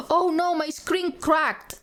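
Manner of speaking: gasp, then high, slow dismay; alarmed gasp. Real, not acted. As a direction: streamer finding her phone screen cracked, high and alarmed. Gasps at cracked phone.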